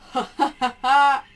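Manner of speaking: loud belly laugh; big booming laugh; hearty laugh. Acted. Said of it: "he can't even laugh without hurting".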